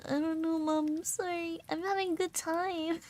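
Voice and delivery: high-pitched mock-whiny apology; imitating a sheepish, pleading daughter; sheepish whine. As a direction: whine an excuse to a parent. Daughter's defensive answer to mom.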